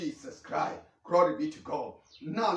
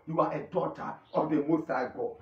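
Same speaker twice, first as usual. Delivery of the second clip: usual speaking voice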